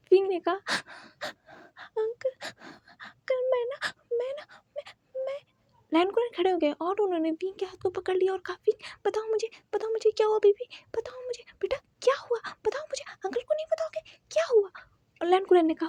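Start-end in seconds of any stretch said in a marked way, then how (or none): 0.5-5.4 breathy, broken fake sobs; stammering mock crying; fake sobbing. Wing fakes tears before uncle. 8.7-14.7 tender coaxing. Acted. Uncle coaxes crying boy tenderly.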